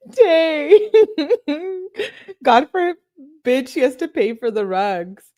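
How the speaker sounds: high, loud laugh breaking into the words. Real, not acted. Reader cracking up over who pays for the rugs, laughing. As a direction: crack up, then slow it down while still amused. Amused jab at the texted argument.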